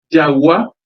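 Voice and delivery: Jagua said firmly, leaning on the final syllable gua; stressed example word. Shown as a demonstration. Teacher introducing Jagua to the class, stress on the final gua. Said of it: "Ág̃akatu oĩ ñe'ẽ pahápe avei".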